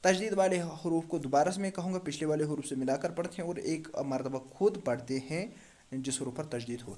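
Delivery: ordinary talk, nothing marked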